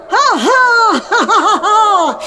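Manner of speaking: high, loud evil cackle; witch cackle. Acted. Witch cackles after flying spell.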